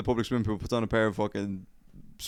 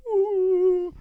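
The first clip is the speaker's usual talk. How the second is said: long, high, loud moan; keen it out, hammed-up; mock pained wail; 6 dB louder than usual. Injured man heard on the playground swings, mock pained wail.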